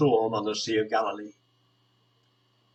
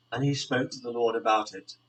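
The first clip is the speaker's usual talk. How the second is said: normal talking voice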